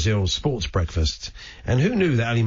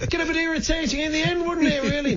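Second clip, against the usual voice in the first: much higher voice keeping up the caller's mimicry; still imitating the caller; faster than usual. Host keeps mocking the caller's speech pattern, higher and fast. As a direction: stay in the caller's odd voice, pitched way up, and quicken. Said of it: "Interesting speech patterns".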